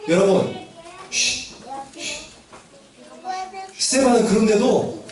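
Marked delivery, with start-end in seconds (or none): 1.1-2.1 real hushing, quiet and unhurried; shushing. Real, not acted. Teacher quieting the children mid-story, shushing.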